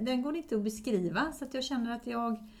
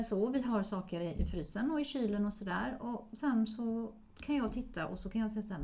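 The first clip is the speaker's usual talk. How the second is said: ordinary talk, nothing marked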